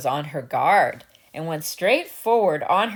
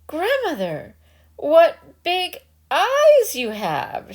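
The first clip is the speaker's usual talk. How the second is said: put-on high, slow childlike amazement; wondering girl; 9 semitones higher, 3 dB louder, slower than usual. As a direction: gasp it out, growing uneasy. Little Red Cap questioning.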